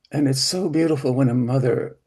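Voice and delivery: ordinary talk, nothing marked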